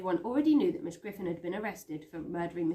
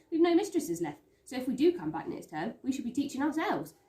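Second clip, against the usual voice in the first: lightly voiced Kitty; 5 semitones higher, 4 dB louder than usual.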